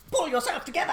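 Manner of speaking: high bossy cartoon voice; Edna Mode impression. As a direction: bark it like the tiny designer.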